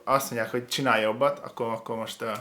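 ordinary talk

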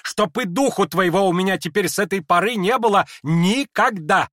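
high, loud raging cry; enraged lady driving the prince away; furious shout. Acted. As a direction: banish him with all her fury. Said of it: "побледнев от гнева".

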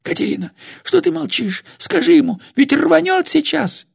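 very high, squeezed plea; choked panic. A narrator's reading. Old woman begs Катерина to stop him, choked with panic. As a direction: plead frantically on a thin, strained pitch. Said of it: "получалось что-то сдавленное".

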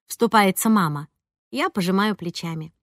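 plain reading voice

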